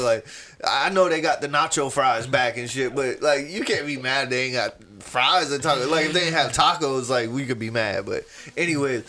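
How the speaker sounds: ordinary delivery, nothing marked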